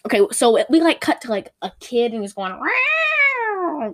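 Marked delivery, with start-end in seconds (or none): 2.5-3.9 high, rising siren "Wow"; siren wail. Retold or imitated. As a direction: crank out a wailing old siren "Wow". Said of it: "like an old-timey siren".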